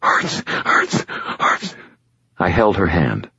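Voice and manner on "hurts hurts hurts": whispered, breathless with pain. A narrator's reading. Injured girl gasps out her pain in a breathless whisper. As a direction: barely get the air out. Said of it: "breathless with pain".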